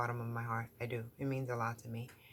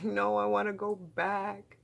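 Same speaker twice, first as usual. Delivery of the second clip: whiny plea; 7 semitones higher, 4 dB louder than usual. Retold or imitated. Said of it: "I was like".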